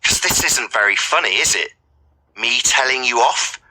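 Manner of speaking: ordinary delivery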